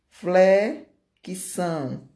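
"flexão" slowly split, first piece drawn out; stretched "flexão" with a pause inside; syllable by syllable. Shown as a demonstration. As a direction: sound out "flexão" in two pieces. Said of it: "Duas sílabas".

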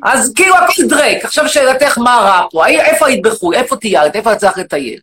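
shouting, indignant rant at the audience; loud mock rant. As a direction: bellow it like an outraged demagogue. Loud persona.

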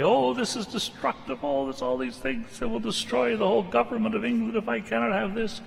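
high-pitched, whiny mock rant; pouting rant. Preacher imitating Humphrey's pouting rant over a key, high and whiny. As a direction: squeal it like a petulant civil servant. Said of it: "he's pouting away".

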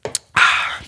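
satisfied "ahh" exhale after drinking; voiceless open-mouthed breath out after a swallow; sigh after a sip. Real, not acted. Podcaster pausing the rant for a drink, exhaled sigh. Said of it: "Just took a sip".

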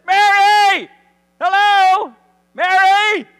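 high, full-volume comic shout; loud calling out. Acted. Risen Jesus calls to Mary who misses him, exasperated shouting. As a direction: holler to get her attention. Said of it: "I love what he says".

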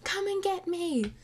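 high, strained call to a friend, half-acted; retelling the film scene with a lightly hammed-up cry for help; mock dying call. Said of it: "he's like".

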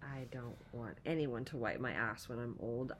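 normal talking voice

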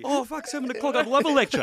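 mocking a driver stuck with a lecture, sarcastic and high. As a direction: fake delight, dripping sarcasm. Mocking a pulled-over driver.

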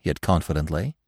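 usual speaking voice

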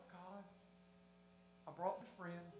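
very soft, low mutter to God; hushed, dismayed aside; worried private prayer. Acted. Churchgoer praying about the visiting friend, hushed worry.

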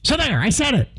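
a hint of a gruff talk-radio host; Mark Levin impression. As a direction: lightly imitate Mark Levin declaring it. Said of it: "That's my Mark Levin imitation".

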